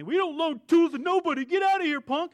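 high, loud barking shop guy; mock yell. The shop staff chasing the speaker out, a loud mocking yell. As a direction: shout him off like a rude mechanic. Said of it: "They're yelling at me".

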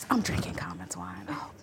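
hushed, voiceless whisper; whispered confiding. Real, not acted. Wine consultant confides whose wine she's drinking, whispered. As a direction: whisper it like a secret to the camera.

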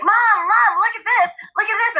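put-on high excited child voice calling mom; imitating a kid. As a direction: play the eager kid begging mom to look. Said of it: "your kids will just be like".

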